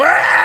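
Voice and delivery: high, loud drawn-out yell; battle cry. Acted. Storyteller charges out on a horse, mock battle scream. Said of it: "I emerge on a horse and go".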